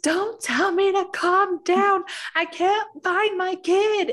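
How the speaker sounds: put-on a mother screaming because her child is missing; panicked yell. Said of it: "I'm on the phone panicked with my husband".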